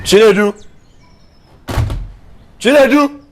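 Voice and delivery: high, loud summoning shout; calling out loudly. Acted. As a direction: bellow the name to summon a worker. Boss summons worker from afar.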